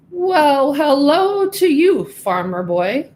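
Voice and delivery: put-on a grand pig showing off to the farm boy; proud pig. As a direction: drawl it out like a boastful show pig. Show pig greets Pedro.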